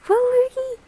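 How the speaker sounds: high, loud excited squeal; excited shout. Real, not acted. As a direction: squeal the name with delight. Greeting a viewer excitedly.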